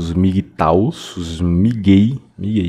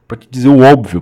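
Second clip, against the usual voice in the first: louder ranting; 4 semitones higher, 7 dB louder, faster than usual. Real, not acted. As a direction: rant at the commenter. Ranting at commenter.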